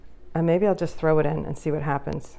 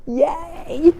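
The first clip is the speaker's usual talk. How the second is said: excited cheer; 11 semitones higher, 7 dB louder than usual. Real, not acted. Delighted by the result.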